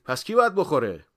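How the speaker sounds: loud, high question back; insistent worker's retort; challenging pupil. A narrator's reading. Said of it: "یارو پرسید".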